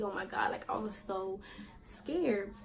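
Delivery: normal talking voice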